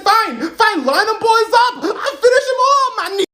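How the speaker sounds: very high, loud mock bravado; defiant outburst. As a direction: shout it defiantly, climbing to a squeal. Defiant comeback to the officer.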